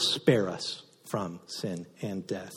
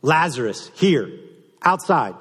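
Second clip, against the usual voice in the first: higher, louder summons, clipped and quick; commanding call repeated; 6 semitones higher, 5 dB louder than usual. Acted. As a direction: call him out again, short and sharp. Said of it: "I love that simple".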